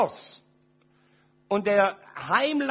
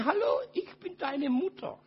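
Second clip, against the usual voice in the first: higher voice cracking on "Hallo, ich bin deine Mutter"; choked-up, high, near crying; voice breaking; 8 semitones higher than usual. Real, not acted. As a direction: let the voice crack with tears on "Hallo". Mother's words retold, voice breaking.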